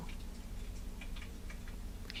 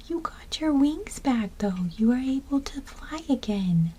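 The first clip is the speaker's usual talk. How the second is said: whispering; 6 dB quieter than usual. Real, not acted. Whispering to the ladybug.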